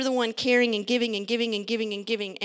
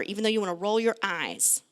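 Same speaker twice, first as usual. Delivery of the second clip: usual speaking voice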